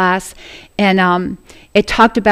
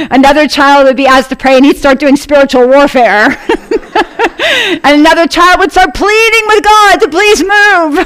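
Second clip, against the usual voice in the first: higher, louder voice full of laughter; laughing while telling; 5 semitones higher, 5 dB louder than usual. Real, not acted. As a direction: tell it through bursts of laughter. Laughing through a funny anecdote.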